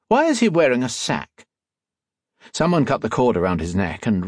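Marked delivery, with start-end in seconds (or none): 0.1-1.5 higher, lightly voiced posh gentleman. A narrator's reading. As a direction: ask it like a posh gent. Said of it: "Another posh voice said".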